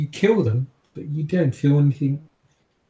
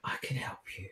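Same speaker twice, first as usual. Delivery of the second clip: secretive whispered offer; 6 semitones lower, 18 dB quieter than usual. Acted. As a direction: whisper it very softly, low and close. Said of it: "he sort of whispers".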